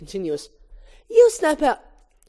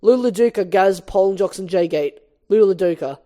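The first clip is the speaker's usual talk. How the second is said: ordinary talk, nothing marked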